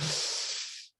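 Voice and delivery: a heavy audible sigh straight into the microphone. Shown as a demonstration. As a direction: demonstrate a noisy, breathy sigh too close to the mic. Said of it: "I'm down here and I'm like".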